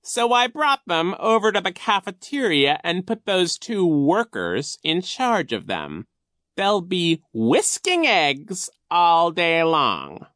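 high, slow, loud villain voice; scheming Coach Genghis. A narrator's reading. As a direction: feign puzzlement with drawn-out villainy. Narrator plays Coach Genghis.

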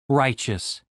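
ordinary delivery

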